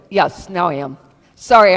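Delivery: usual speaking voice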